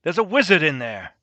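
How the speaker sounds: urgent warning. Acted. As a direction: call out a warning to your friend. Character warns companion in game.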